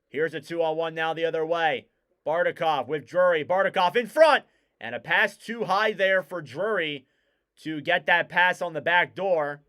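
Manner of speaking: brisk hockey commentary, rising on chances; live play-by-play. Real, not acted. Commentator calls the Islanders game for listeners, live play-by-play. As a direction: follow the puck, lifting the call as danger builds.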